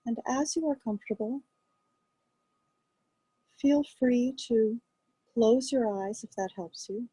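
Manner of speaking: calm, quiet guiding voice with long pauses; guided meditation. Acted. Teacher guiding a group to rest attention on breath, calm meditation voice. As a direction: ease listeners gently into noticing the breath, unhurried.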